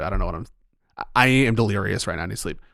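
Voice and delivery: usual speaking voice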